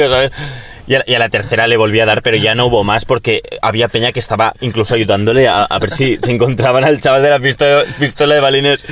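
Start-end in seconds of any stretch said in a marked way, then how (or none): none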